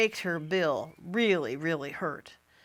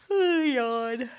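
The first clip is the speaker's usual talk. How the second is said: slow sighing yawn; sleepy yawn; 3 semitones higher than usual. Acted. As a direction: let out a big sleepy yawn. Hibernating toad yawns.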